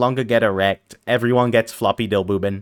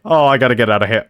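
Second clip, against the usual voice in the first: mock panic; 3 semitones lower, 3 dB louder, faster than usual. Fleeing the witch, mock panic.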